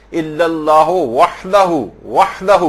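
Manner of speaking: وحدہ with a strong breathy ح from the throat; clear ha sound. Shown as a demonstration. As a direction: stretch وحدہ slowly, voicing the ح clearly. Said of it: "صحیح آپ کو ہا کی آواز نکالنی ہے". (وحدہ میں ح کی صاف آواز)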